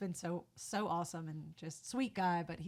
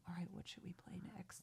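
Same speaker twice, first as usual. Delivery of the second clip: very soft, secretive whisper; hushed imitation of onstage conferring; whispered aside; 13 dB quieter than usual. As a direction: whisper to a bandmate mid-show. Band conferring during silence on stage.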